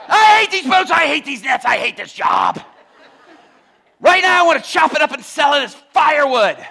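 high, shouted exasperation; fed-up fisherman hollering at full tilt; Peter ranting. Acted. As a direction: yell in a hammed-up fit of frustration. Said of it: "I imagine Peter looks at him and says".

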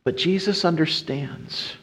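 usual speaking voice

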